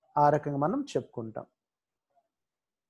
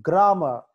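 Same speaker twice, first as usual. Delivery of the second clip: Grammar with a loud "gra"; grammar, strong start, falling end; stress at the G; 3 semitones higher, 7 dB louder than usual. Shown as a demonstration. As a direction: punch the first syllable of grammar. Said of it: "జి దగ్గర".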